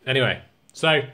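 ordinary talk

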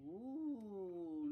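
long, loud "ooh" gliding down; drawn-out ooh. Acted. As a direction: stretch out a big storybook ooh. Pirate quote in the story.

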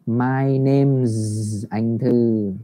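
very slow, name's ending on a long buzzing z; clear z in name's. Shown as a demonstration. Teacher asks the class to listen to the z, very slow. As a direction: stretch out the z of name's. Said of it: "Rõ cái âm đấy ra".

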